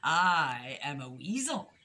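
low, slow villain voice; ominous storybook weasel reveal; sinister weasel. A narrator's reading. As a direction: draw it out with creeping menace. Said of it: "she whispered".

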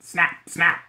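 snapping. Acted. Alan scaring in jungle.

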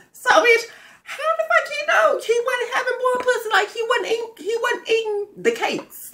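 high, squealing falsetto full of disbelief; mock disbelief. Mocking the ex's denial, high laughing falsetto. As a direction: shriek the question in a high, laughing voice.

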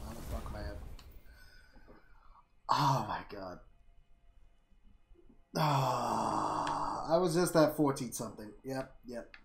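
ordinary talk

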